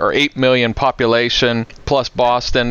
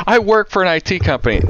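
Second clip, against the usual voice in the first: laughing quote; 8 semitones higher, faster than usual. Retold or imitated. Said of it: "she's like".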